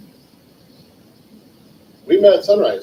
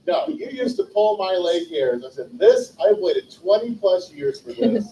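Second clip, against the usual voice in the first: mock gloating; 7 semitones higher than usual. Payback for childhood prank.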